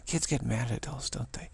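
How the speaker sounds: normal talking voice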